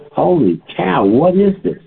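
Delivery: imitating the doctor's amazement; amazed. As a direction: retell her stunned surprise.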